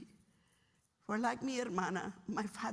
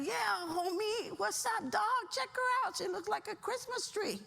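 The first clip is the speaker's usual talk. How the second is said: homie banter; 10 semitones higher than usual. Retold or imitated. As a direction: answer your buddy like a street homie. Acted second homie's reply.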